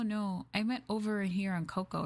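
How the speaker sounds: usual speaking voice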